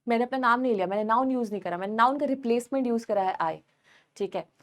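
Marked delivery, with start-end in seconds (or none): none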